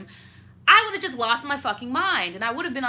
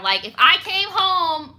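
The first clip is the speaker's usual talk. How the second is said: worked up; 8 semitones higher, 6 dB louder than usual. Real, not acted. Imagining catching partner.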